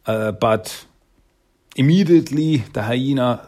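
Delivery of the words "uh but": normal talking voice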